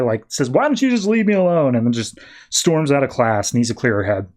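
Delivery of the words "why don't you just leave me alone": high-pitched mock outburst; acted exasperated flare-up, pitched up; freaking out. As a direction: imitate snapping at someone to back off. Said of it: "She freaks out on the guy".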